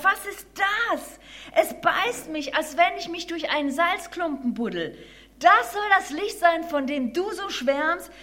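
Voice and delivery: high, loud, pained protest; outraged mole. Acted. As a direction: complain loudly as the light stings. Mole in pain, outraged at light.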